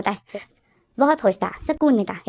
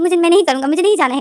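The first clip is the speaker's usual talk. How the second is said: higher, louder retold yell of no; mock raised-voice refusal; defiant refusal; 7 semitones higher, 4 dB louder than usual. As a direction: play the stubborn boy shouting back, half-acted. Boy refuses to pack, defiant.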